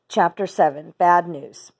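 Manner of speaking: ordinary delivery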